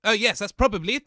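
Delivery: usual speaking voice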